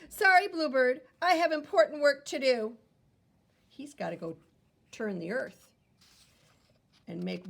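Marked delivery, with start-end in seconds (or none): 0.1-2.8 louder, brisk polite worm; busy worm voice. Acted. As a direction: brush off the bird politely. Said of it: "what he says".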